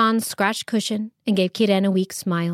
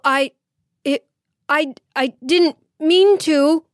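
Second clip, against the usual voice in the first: higher, louder, slowed stammering; embarrassed stammer; 7 semitones higher, 4 dB louder than usual. Acted. Abu Hassan apologizes to his guests, flustered stammer. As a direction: stumble over the apology. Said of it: "embarrassed horror".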